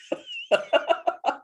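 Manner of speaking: high burst of laughter. Real, not acted. Sharing an unexpected sword moment, laughing. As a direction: break out in a real laugh. Laughing after retelling her awe.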